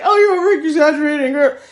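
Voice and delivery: dismissive husband, played up; brushing it off as husband; husband's dismissive voice. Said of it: "my husband was like".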